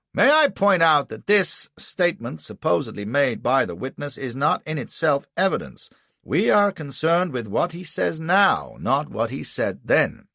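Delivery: high, loud formal protest; objecting defence counsel. A narrator's reading. Anderson challenges the statement as evidence, emphatic objection. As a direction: object firmly, slowing to stress the contrast.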